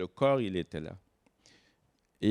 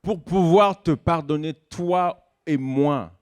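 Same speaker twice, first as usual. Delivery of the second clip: emphatic preaching; 5 semitones higher, 6 dB louder, slower than usual. Real, not acted. Preaching climax.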